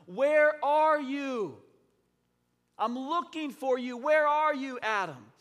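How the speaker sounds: God calling out for Adam; calling out searchingly. Acted. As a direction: call out to someone you are searching for.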